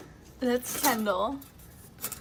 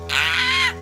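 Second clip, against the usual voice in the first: excited squeal; 4 dB louder than usual. Real, not acted. Monday scream.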